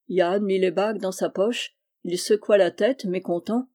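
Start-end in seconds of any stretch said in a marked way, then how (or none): none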